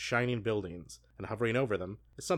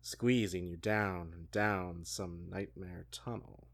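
sultry voice; 3 semitones lower, slower than usual. Acted. Host reading the swallowing scene to cohost, hammed-up sultry voice. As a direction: drag every word out teasingly. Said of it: "bust out our sultry voices".

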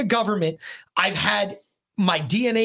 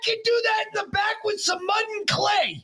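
incredulous shout. Real, not acted. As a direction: bellow it in disbelief. Outraged at the statue's price.

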